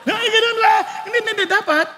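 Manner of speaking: high, laughing protest. Real, not acted. Laughs at own story. (natatawang pagtanggi)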